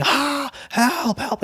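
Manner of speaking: high, thin-volume cry for help; half-voiced high screaming; mock scream. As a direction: imitate a panicked scream without the loudness. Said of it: "she starts screaming".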